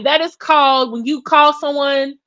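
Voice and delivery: ordinary talk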